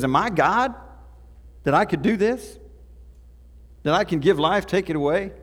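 loud, anguished protest; desperate king. Acted. King of Israel protests to the absent king of Aram, loud and anguished. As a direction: cry out as a man asked the impossible. King tears clothes in distress.

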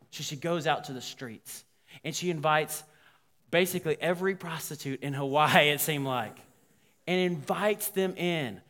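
normal talking voice

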